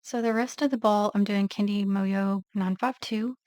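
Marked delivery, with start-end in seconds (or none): none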